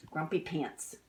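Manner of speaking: plain reading voice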